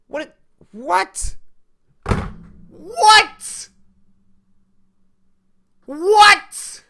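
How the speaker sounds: high, loud incredulous what; shocked disbelief. Real, not acted. Shocked at maintenance.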